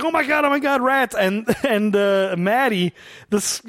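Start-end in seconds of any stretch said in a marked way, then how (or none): 0.0-1.1 higher, hammed-up freak-out; a half-joking, higher oh-my-God; mock panic. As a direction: imitate a convict panicking over rats. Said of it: "it's just like".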